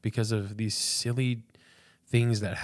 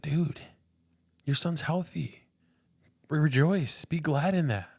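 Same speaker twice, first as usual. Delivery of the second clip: higher, bright encouraging voice of God, slowed on "your son's healthy"; voicing God, uplifted; 7 semitones higher, 3 dB louder than usual. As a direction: lift it up like a friend cheering him on. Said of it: "He was like".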